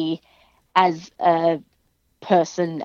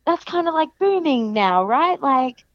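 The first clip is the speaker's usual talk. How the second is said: pitched well higher, bubbly put-on fan voice; imitating American fans; 6 semitones higher than usual. Quoting American fans who ask about Australian wrestling, higher and bubbly. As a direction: play the eager American asking about Australia. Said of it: "They're like".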